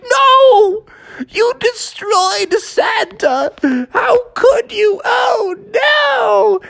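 put-on very high wailing boy voice; distraught Froggy. Froggy wailing at his sister over the broken Santa. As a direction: wail it way up high. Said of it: "And Froggy's like".

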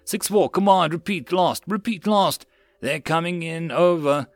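high, urgent radio plea; panicked yells. A narrator's reading. Gunner begging command over comms, panicked yells. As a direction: call for orders in rising panic. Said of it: "panicked yells came across the comms".

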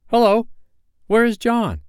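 put-on high, loud boyish question; abrupt, high-pitched enquiry; suddenly noticing. A narrator's reading. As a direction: call out as you notice someone missing. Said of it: "he asked, suddenly missing the third bed".

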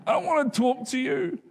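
high, whiny mock protest; hammed-up whiny refusal; childish protest. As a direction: plead like a kid wanting out. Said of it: "I'm like".

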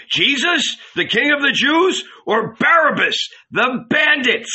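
very high, carrying call to a crowd; shouted question. A narrator's reading. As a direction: shout the choice out to the mob. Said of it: "he shouted".

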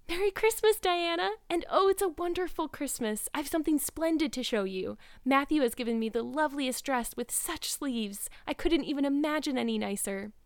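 put-on high, bubbling girlish delight; excited young Anne. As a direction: burst out with happy news for a best friend. Anne greets Diana with news.

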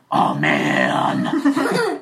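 low, drawn-out dismayed groan; deep, hammed-up dismay; dismayed giant dwarf. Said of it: "he looks down and he goes".

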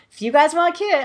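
put-on high-pitched, half-hearted British accent; attempted British accent. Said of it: "I can't do a British accent".

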